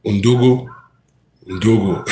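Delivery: mock African accent. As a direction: call the name in a put-on African accent. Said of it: "That's my best African accent right now".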